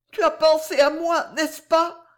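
high, loud, pleading question; acted anguished heroine's appeal; anguished woman in love. A narrator's reading. Anguished heroine questions her lover.

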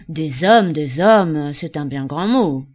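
loud, smiling coax. Acted. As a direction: charm her with a grin. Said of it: "avec un sourire enjôleur".

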